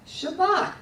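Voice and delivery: "Shabbat" said high and loud, stress on the last syllable; lively teacher model, shəBAT; model pronunciation. Shown as a demonstration. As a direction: call out "Shabbat" brightly for the class to repeat. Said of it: "Say it".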